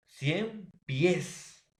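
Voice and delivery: "cien pies" split, with high, stretched "pies"; stress on last syllable. Shown as a demonstration. Teacher giving an aguda example, last syllable stressed in "cien pies". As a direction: pause after "cien", then lean hard on "pies". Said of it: "la mayor fuerza de voz en la última sílaba".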